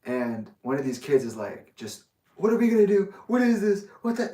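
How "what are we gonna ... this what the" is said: high, loud panicky mock yell; freaking out. Kid at camp panicking in the stable, freaking out. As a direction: imitate a panicking kid, pitch jumping up. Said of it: "he's just freaking out".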